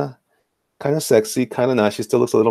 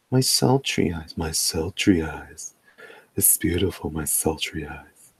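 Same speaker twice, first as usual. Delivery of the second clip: sultry; 5 semitones lower, 6 dB quieter than usual. Pretend. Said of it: "give her the sultry eyes".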